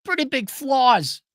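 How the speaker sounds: a sudden high wait-a-minute take; realizing aloud. Retold or imitated. Talking to himself about an old flame, high and dawning. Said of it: "I'm like".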